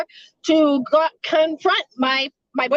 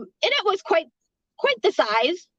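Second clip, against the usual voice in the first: normal talking voice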